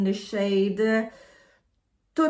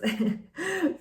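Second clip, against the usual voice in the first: short laugh; 9 semitones higher than usual. Real, not acted. Laughs at her own hoarding.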